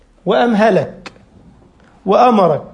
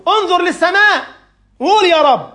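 higher, urgent call; voice of instinct; 8 semitones higher than usual. Retold or imitated. Instinct calling the drowning atheist to pray, higher and urgent. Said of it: "الفطرة بتقول له".

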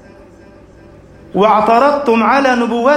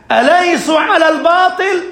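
a hurt, angry appeal to the Prophet; angry protest; 7 semitones higher than usual. Acted. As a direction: Umar bursting in on the Prophet, angry and calling out. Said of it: "غاضبا".

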